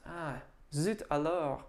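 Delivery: usual speaking voice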